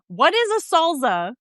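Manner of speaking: mock dad, pitch up; playful dad impression; channeling dad. Asking about salsa as her dad would. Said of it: "I'm channeling my dad".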